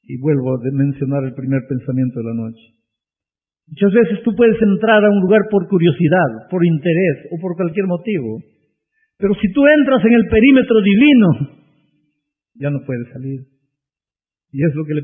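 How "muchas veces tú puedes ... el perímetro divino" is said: raised, high preaching voice; fervent preaching, pitch and volume rising. Acted. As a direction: preach it out to the whole church. Preacher builds at full force.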